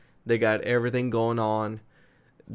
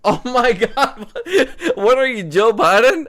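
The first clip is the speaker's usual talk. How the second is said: laughing; 12 semitones higher, 9 dB louder than usual. Real, not acted. Laughing at friend's frozen connection.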